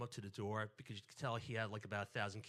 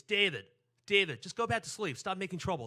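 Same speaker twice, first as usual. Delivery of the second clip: loud, high-pitched scolding yell; mom's scream; 6 semitones higher, 12 dB louder than usual. Retold or imitated. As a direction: imitate a mother screaming her child back to bed. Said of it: "my mom screams".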